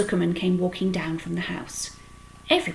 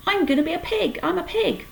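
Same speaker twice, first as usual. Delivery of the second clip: put-on 1 excited boy; 11 semitones higher, 3 dB louder than usual. A narrator's reading. Avery showing off excitedly.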